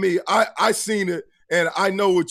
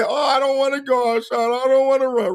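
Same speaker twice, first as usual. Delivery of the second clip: put-on whiny kid; 6 semitones higher than usual.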